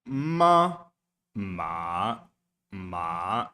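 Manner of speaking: copying each "Ma" after the tape; imitating Chinese tones. Shown as a demonstration. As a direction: echo the tape's tones. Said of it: "repeating the tones after the tape".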